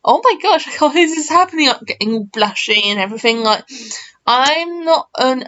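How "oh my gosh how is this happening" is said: high, fast, laughing oh my gosh; thrilled, laughing oh my gosh; excited disbelief. Retold or imitated. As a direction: gush with happy disbelief. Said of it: "It's a very, like".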